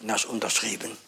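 ordinary delivery

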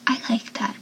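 breathy, teasing whispered line; mock-sultry whisper; sexy whisper. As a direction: purr it softly. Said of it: "in that sexy, teasing way".

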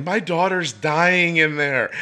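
high, drawn-out mock yell; retold panicked yell. Dad imagines himself banging on the school door, mock panicked yell. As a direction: act out a frantic parent without full volume.

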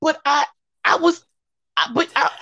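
high, halting sputter of a put-upon mother; exasperated mama. Retold or imitated. Acting out the blamed mama answering her child, exasperated. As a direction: imitate a mom stammering in disbelief. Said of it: "Poor mama in the back, like".

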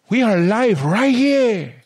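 very high, slow, drawn-out Spanish accent. Shown as a demonstration. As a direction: belt it like a hammed-up Spanish TV host.

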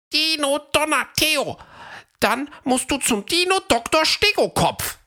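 high, urgent dino voice; concerned Fred. A narrator's reading. Fred tells sick Theo to see the doctor, urgent. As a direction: insist he goes to the doctor.

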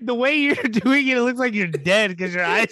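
giggling through the words; laughing. Real, not acted. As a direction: talk while cracking up. Cracking up at the bit.

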